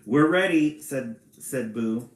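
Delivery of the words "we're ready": loud, higher, lightly Irish leprechaun; attempted Irish accent. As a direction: call it out with a hint of Irish. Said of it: "Read it in an Irish accent".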